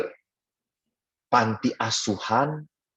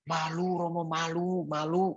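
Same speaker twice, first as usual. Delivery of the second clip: put-on soft, higher bashful child voice; mock shy reluctance, very softly; meniru anak malu; 4 semitones higher, 9 dB quieter than usual. Acted child too ashamed to apologize. (tiruan anak malu-malu, lirih)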